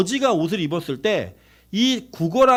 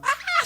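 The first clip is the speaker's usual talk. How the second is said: mock scream. Preacher imitating women screaming on rides, high mock shriek. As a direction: imitate a screaming woman in falsetto. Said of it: "소리 지르는 여자들".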